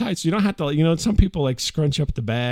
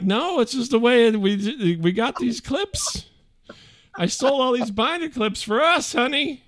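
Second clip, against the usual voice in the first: meek husband; 9 semitones higher than usual. Retold or imitated. Husband placating angry wife, meek and high. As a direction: soothe her meekly, pitched up. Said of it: "I'm like".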